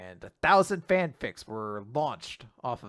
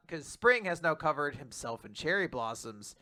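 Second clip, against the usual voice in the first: normal talking voice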